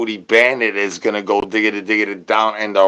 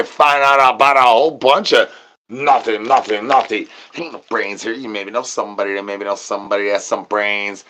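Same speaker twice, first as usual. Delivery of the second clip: ordinary delivery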